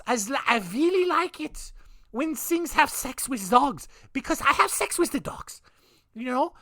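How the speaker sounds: high, hammed-up creepy fanboy voice; Peter Curtin mock. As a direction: squeal along like an eager pervert in the audience. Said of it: "just sitting there like".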